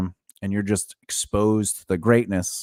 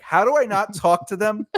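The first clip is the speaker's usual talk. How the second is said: mock imitation, higher; 8 semitones higher than usual. Imitating partner, raised pitch.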